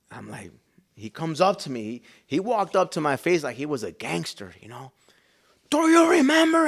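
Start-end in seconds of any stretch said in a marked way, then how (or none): 5.7-6.7 preacher's confronting shout. Retold or imitated. Preacher up in his face, confronting shout. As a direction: shout the question right in someone's face.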